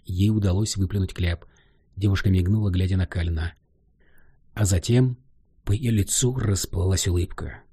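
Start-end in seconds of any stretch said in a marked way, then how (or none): none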